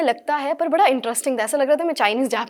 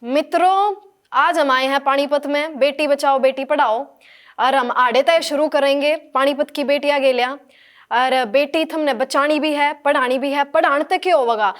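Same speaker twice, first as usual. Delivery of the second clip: loud Haryanvi political speech; booming public oration with Haryanvi accent; Modi speech in Haryanvi; 3 semitones higher, 5 dB louder than usual. Acted. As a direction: address the rally in Haryanvi, projecting. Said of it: "Modi जी की कोई speech".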